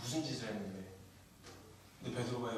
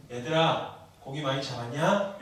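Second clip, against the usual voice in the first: Jesus calling out; 7 dB louder, slower than usual. Acted. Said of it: "이렇게 물어보세요".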